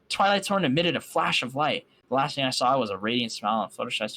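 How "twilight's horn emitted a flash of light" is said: ordinary delivery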